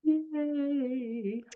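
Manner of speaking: high, drawn-out little yay; soft cheer. Real, not acted. Host applauding a panelist's introduction, soft cheer.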